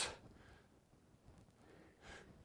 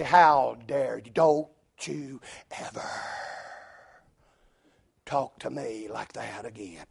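mock indignation; 7 semitones lower, 6 dB quieter than usual. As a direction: draw it out low, sinking to very quiet. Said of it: "bristle up and get mad and say".

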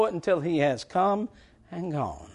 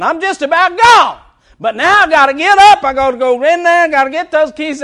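loud, high comic exasperation; worked-up, high-pitched storytelling; hammed-up preacher's complaint; 10 semitones higher, 11 dB louder than usual. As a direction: holler the grievance from the pulpit.

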